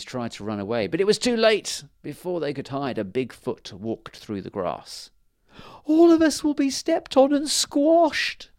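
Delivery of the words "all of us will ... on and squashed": much higher, slow, louder queen's fearful cry; tiny queen dreading being squashed; Fairy Queen crying out. Acted. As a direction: wail it like a frightened fairy queen. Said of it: "cried the Fairy Queen".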